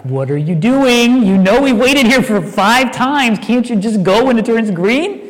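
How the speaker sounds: slow, put-upon grumble at the driver; a touch of annoyance, not yet angry; mild retold irritation. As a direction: imitate being mildly fed up in traffic. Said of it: "I'm like".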